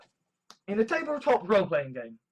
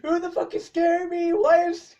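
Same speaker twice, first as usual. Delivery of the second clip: panicked; 7 semitones higher, 6 dB louder than usual. Retold or imitated. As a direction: freak out loudly, higher and higher. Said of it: "You're like".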